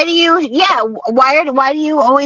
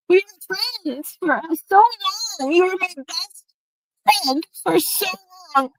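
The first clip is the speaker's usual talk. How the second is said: quiet, broken with tears; barely getting the words out through crying; soft real sobbing; weeping; 4 semitones higher, 6 dB quieter than usual. Real, not acted. Weeping over lost friendship.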